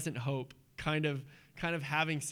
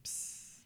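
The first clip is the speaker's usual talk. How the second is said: whispered hush; 14 dB quieter than usual. Retold or imitated. Jesus hushing the storm.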